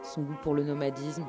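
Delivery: ordinary delivery, nothing marked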